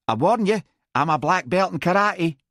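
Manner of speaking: high, whining threat; high-pitched warning. A narrator's reading. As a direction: warn him off in a high voice. Squaring up to threaten him.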